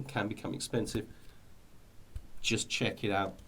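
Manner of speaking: ordinary talk, nothing marked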